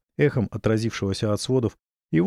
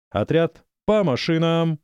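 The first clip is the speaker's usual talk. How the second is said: higher, louder, slow command; drawn out order to the troops; shouted command; 7 semitones higher, 3 dB louder, slower than usual. Acted. Major orders troops to vehicles.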